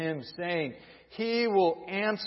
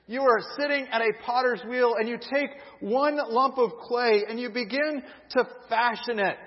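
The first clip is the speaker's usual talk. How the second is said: usual speaking voice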